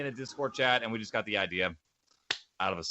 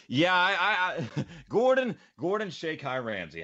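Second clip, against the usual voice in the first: high, laughing mock nickname; laughing tease; 8 semitones higher than usual. Real, not acted. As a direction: tease him with a silly name while laughing.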